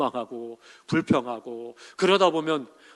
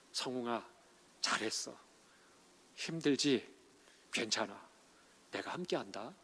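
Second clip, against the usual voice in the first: soft, hushed words of comfort from God; tender comfort; 4 semitones lower, 12 dB quieter than usual. Retold or imitated. Pastor voicing God comforting him, softly and tenderly. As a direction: speak it like a hand laid gently on a tired back.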